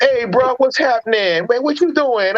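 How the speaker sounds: mock cool "hey bro" greeting from the movie guys; hip dudes greeting. Retelling the Soul Brothers greeting Leroy with a hammed-up high voice. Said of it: "And they were like".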